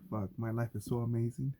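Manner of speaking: normal talking voice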